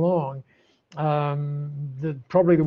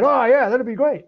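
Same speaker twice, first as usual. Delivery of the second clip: higher, louder, breezy agreement; breezy Aussie bloke; 8 semitones higher, 6 dB louder than usual. Retold or imitated. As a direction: play an Aussie bloke cheerfully missing the point. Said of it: "Aussie blokes tend not to be too deep".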